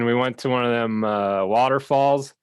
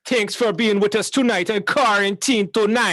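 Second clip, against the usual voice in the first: host sign-off; 7 semitones higher than usual. Acted.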